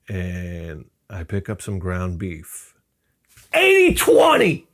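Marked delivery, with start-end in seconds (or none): 3.5-4.7 very high, loud, drawn-out yell; triumphant shout. Acted. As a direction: bellow it like a battle cry. Shouting his meat choice.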